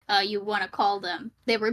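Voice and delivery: normal talking voice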